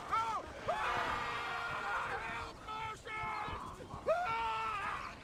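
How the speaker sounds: calling out. Acted. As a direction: yell directions across the set. Director calling out to zombie actors.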